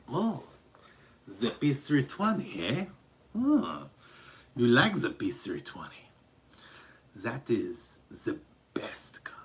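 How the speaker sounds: mock Eastern European accent, hushing to whispery emphasis in places; Baba Yaga. Streamer playing Baba Yaga praising a pistol on request, hammed-up Eastern European accent. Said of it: "some kind of Eastern European fluke".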